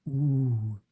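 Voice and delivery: Ooh held long like the vowel in roof; prolonged oo. Shown as a demonstration. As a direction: stretch Ooh into a steady hum-like vowel. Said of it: "but prolonged".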